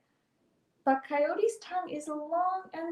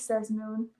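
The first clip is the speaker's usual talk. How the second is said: plain reading voice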